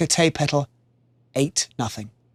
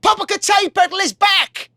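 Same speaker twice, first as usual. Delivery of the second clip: excited shout. Acted. Palace guard announcing the warrior's return, shouting. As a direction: shout the news across the palace. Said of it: "a palace guard shouted".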